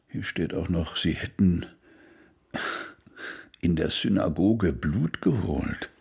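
soft, breathy whisper, slow; quiet conspiratorial whisper, drawn out; hushed reading. Acted. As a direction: read the note under your breath so no one overhears. Reading an anonymous letter aloud, hushed.

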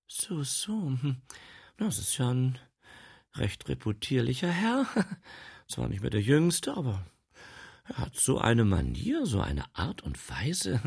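high, giggly, coy; coy housekeeper. Acted. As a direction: swoon over the man. Housekeeper swooning.

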